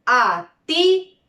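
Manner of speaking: atípico broken into syllables, TÍ stressed; stress on antepenultimate syllable. Shown as a demonstration. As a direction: lean on TÍ in atípico. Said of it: "en la antepenúltima sílaba tengo las palabras".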